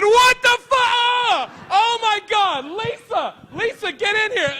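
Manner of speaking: high, loud disgusted shriek; girl discovering the mess shrieks for Lisa. Retold or imitated. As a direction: scream in horror at the mess. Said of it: "you hear one girl just go".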